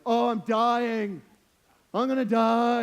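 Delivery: loud, drawn-out mock agony; exaggerated hungry complaint, stretched and loud; overdramatic. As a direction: wail it like a hammed-up starving person. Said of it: "just an overdramatic statement".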